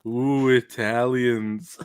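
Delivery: slow, drawn-out ghostly ooh; mock spooky. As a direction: present a silly thing as an eerie mystery. Parodies the show's spooky reveal.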